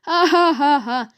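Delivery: acted storybook laugh; burst of laughter. A narrator's reading. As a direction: laugh it out in separate ha's. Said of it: "burst out laughing".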